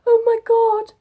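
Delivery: high, loud imitation of an overcome mum; emotional mum. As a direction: wail it like a tearfully thrilled mum. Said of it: "walked off and cried".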